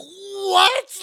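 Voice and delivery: loud, very high shriek of disbelief; hammed-up scream of amazement; astonished shriek. Fan retelling how Malcolm said yeah, shrieked amazement. Retelling losing his mind meeting idol.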